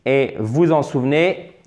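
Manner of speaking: ordinary talk, nothing marked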